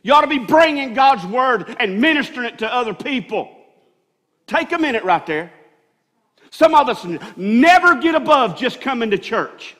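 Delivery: building sermon shout ending in a laugh; full-force preaching. Acted. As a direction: preach it hard to the congregation.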